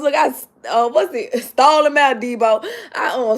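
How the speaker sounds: normal talking voice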